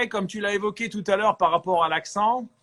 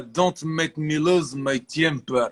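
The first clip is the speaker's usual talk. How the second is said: slow English line in a thick, guttural Russian accent; throaty Russian-accented English; slower than usual. Shown as a demonstration. Actor shows coach an audition line in English, Russian accent. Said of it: "tes textes en anglais avec l'accent russe".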